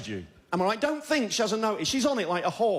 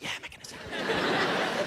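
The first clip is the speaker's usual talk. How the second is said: airy contented whisper; 7 semitones higher, 3 dB quieter, slower than usual. Acted. Blissful inner voice.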